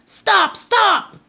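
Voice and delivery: high, loud repeated stop; yelling stop. Acted. Old man chasing the runaway, high loud yell. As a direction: shout after him twice. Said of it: "yelled the little old man".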